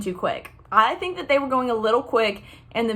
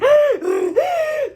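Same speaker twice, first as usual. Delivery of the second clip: very high, loud gasp of delight; excited gasp; 4 dB louder than usual. Retold or imitated. Runner spotting a hometown Instagram runner, gasping with excitement. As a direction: gasp like you just spotted a celebrity. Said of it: "I went from being like".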